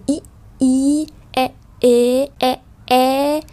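"i" and "e" as isolated vowels, short then held; âm ngắn, âm dài. Shown as a demonstration. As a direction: alternate short and stretched "i, i, e, e". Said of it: "âm ngắn, âm dài". (đọc mẫu "i", "e" ngắn rồi kéo dài)

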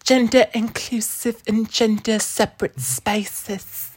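put-on slow, high orphan-child voice; mock Dickensian orphan. Imitating a YouTuber's views, high Dickensian orphan voice. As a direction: spell it out in a high mock waif voice. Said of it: "she's like a Dickensian, like, orphan child".

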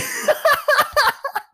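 real high, squealing laugh; cracking up. Real, not acted. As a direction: burst out in helpless high laughter. Cracks up at the comeback.

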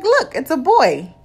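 high, loud and slow announcement; imitating the nurse announcing a boy; high sonographer voice. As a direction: sing it out like a cheery nurse. Sonographer voiced in retold scene.

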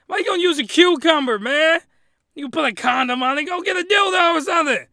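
loud, high, incredulous scolding; exasperated rant, half-playful; mock indignation. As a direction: tell him off in comic outrage.

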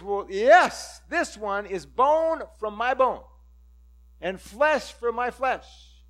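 loud, slow, beaming declaration; thrilled Adam. Acted. Adam declaring the woman his own flesh, loud and slow. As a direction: savour each word, overjoyed.

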